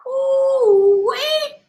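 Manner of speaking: squealed high ooh-wee of delight. Real, not acted. Host hyping the headlines, squealing ooh-wee.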